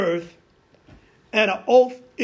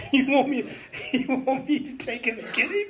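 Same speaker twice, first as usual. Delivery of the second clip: incredulous question through laughter; laughing disbelief; 3 semitones higher than usual. Acted. Abraham laughing at God's request, incredulous and amused. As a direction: laugh as if it can't be serious.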